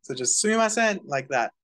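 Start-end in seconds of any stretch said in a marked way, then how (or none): 0.4-1.0 sumimasen loud and even, pitched high; high, loud, level sumimasen; equally loud syllables. Shown as a demonstration. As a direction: call out sumimasen with every syllable equally strong. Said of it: "if you say it all equally and loud".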